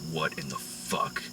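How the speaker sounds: mock-threatening mob boss asking about steak; menacing Whitey. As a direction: lean in low and deadly over dinner. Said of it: "Whitey's like".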